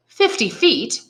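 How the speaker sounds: cried in terror. A narrator's reading. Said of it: "cried Dantes with a sort of terror".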